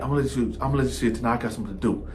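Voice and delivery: evasive mumble. Retold or imitated. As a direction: rush out the excuse and trail off. Cellmate evasively mumbling excuses.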